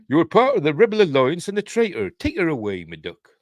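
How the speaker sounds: put-on loud, high start falling away, West Country British accent; Southern British Darth Vader. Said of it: "Not like Darth Vader had. ... That's what he talked like".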